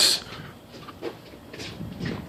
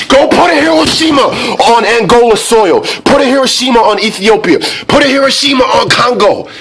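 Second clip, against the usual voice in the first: high, loud ranting yell; worked-up, hammed-up tirade; shouted mock orders; 13 semitones higher, 5 dB louder than usual. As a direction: shout each command at a very high pitch. Rant escalates into shouted mock orders.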